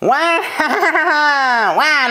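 high cartoon "wah" cry; Wario-style "wah". Player practising Wario's catchphrase, high yelped wah. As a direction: punch out a high, exaggerated wah. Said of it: "The last wah was actually pretty nice".

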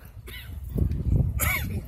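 choking cough after water. Real, not acted. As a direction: splutter and cough hard. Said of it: "he almost choked".

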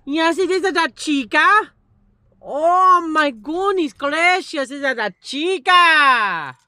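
high, loud, thrilled cooing. Real, not acted. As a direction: squeal with delight at the dog. Excited greeting of the dog.

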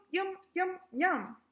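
plain reading voice

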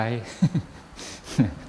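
a short amused laugh; real chuckle. Real, not acted. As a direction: chuckle at the joke. Monk laughs at layman's reply.

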